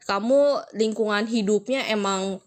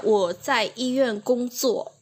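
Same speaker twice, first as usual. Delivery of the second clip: "Wo zai yi yuan gong zuo" said slowly and clearly as the right version; careful demo of the correct sentence; slow correct model; 3 semitones higher, slower than usual. Shown as a demonstration.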